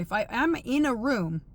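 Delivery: ordinary talk, nothing marked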